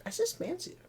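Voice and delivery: very high, soft mock-British murmur; posh put-on voice. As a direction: say it like a dainty aristocrat. S1 mocks a posh dismissal.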